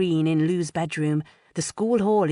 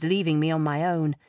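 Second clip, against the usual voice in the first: plain reading voice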